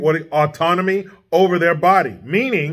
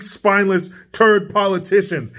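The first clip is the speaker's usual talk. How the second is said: usual speaking voice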